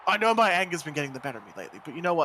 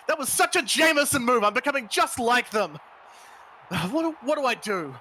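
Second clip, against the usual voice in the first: higher and louder, yelling in dismay; anguished shout; 5 semitones higher, 4 dB louder than usual. Acted. Wrestler horrified he acted like his rivals, shouting. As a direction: cry out in horror at yourself.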